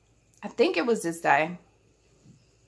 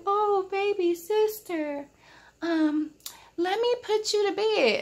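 high, sugary big-brother voice, slowed down; fake-nice big brother; 12 semitones higher, slower than usual. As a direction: coo it like a brother pretending to be sweet. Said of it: "acting all nice to me".

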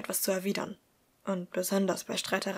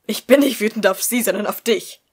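louder, cutting reproach; angry; 11 dB louder than usual. Acted. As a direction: throw the anger back at him. Thaddeus angry at Tobias. (Thaddeus wirft Tobias laut seine Wut vor)

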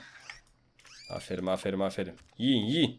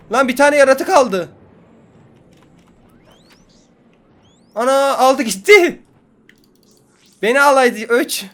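high, loud, slow yelling; loud exclaiming; 9 semitones higher, 10 dB louder than usual. Real, not acted. Gamer yelling as the spaceship takes a creature, loud and high. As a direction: shout it out slowly in dismay.

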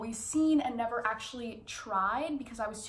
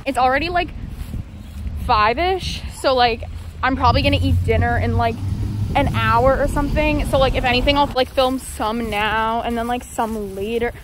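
muffled behind surgical mask; speaking masked while walking; through a face mask; 12 dB louder than usual. Real, not acted. Seen 5: Blue surgical mask covers mouth and nose throughout the labelled words.

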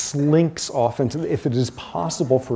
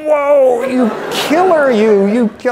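much higher and louder, a teasing "whoa, you killer"; hammed-up awe at his wife; a playful, excited exclamation of mock admiration; mock proud excitement; 10 semitones higher, 8 dB louder than usual. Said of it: "I was so proud of her".

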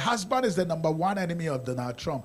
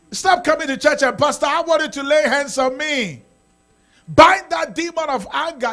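mocking shout; 9 semitones higher, 10 dB louder than usual. Preacher mocking needy churchgoers.

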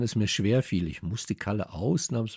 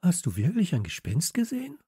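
high, timid question; mildly frightened girl's voice; anxious; 9 semitones higher than usual. A narrator's reading. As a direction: ask it with a touch of fear. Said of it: "fragte Radieschen ängstlich".

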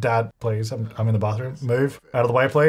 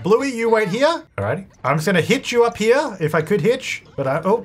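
ordinary talk, nothing marked

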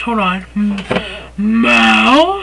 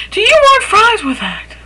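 high, sing-song fries upsell; upselling cashier; 9 semitones higher than usual. Shown as a demonstration. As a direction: chirp it like an eager cashier. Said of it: "Repeat after me".

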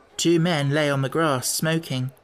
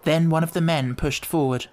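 ordinary delivery

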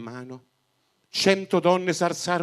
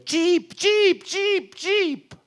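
high piercing chirps of a sparrow; loud bird chirping; 6 dB louder, much slower than usual. A narrator's reading. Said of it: "si sentì un forte cinguettio".

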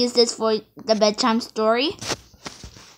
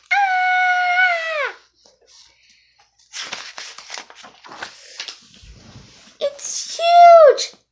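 high, loud startled cry; shocked pigeon; 4 dB louder, much slower than usual. Acted. Pigeon faced with the huge dog, high shocked cry. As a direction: gasp at the sheer size.

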